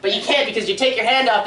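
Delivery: ordinary talk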